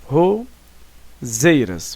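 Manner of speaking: "roseiras" very slow, broken into "ro" and "zeiras"; syllable by syllable. Shown as a demonstration. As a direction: split "roseiras" with a clear pause after "ro".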